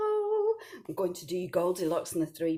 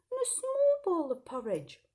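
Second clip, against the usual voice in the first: much higher, small voice for the little bowl; tiny voice; 13 semitones higher than usual. A narrator's reading. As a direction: squeak it like something tiny. Tiny bowl for Baby Bear.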